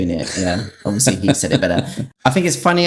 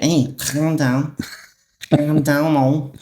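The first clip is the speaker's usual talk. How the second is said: imitating him; 5 semitones higher, slower than usual. Retelling what he said.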